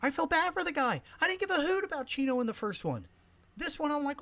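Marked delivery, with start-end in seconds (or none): none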